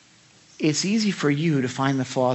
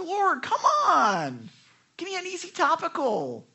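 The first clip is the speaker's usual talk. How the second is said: mock tantrum; 11 semitones higher, 3 dB louder than usual. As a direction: whine to God like a kid begging off a chore. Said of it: "I have a little tantrum".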